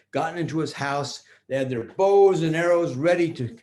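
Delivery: usual speaking voice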